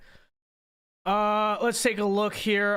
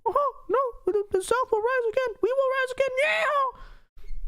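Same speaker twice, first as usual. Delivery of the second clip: much higher squeaky cartoon voice, building to a cheer; Dixie Steve voice. Puppet python insists after being told to calm down, squeaky rallying cartoon voice. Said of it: "he talks like Mickey Mouse".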